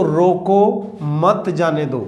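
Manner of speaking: रोको set apart by a pause, then मत जाने दो run together; pause after रोको. Shown as a demonstration. Hindi teacher showing how punctuation changes meaning, pause after रोको. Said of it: "यहाँ मैंने ये विराम चिन्ह लगा दिया".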